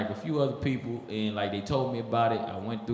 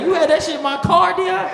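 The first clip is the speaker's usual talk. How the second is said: high, loud shocked question; alarmed shout; 12 dB louder than usual. Retold or imitated. Comedian shocked Dion carried drugs in his car, loud. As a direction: freak out at Dion.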